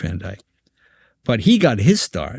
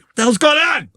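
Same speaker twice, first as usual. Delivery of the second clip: Frank's temper. Retold or imitated. Frank startled by the rolling log, angry shout. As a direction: snap at the room like a hot-tempered boss. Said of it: "Frank had a temper".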